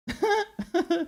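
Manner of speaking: a short high giggle at the headlines; real laugh. Real, not acted. Host reading absurd headlines, a short high soft laugh. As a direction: burst out laughing to yourself. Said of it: "I'm just laughing at the headlines".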